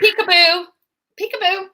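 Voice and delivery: high teasing singsong; high playful peekaboo. Retold or imitated. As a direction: tease it like a game. Mocking the key discovery.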